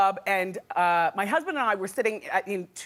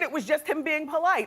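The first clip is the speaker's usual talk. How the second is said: ordinary talk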